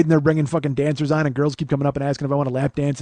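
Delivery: ordinary delivery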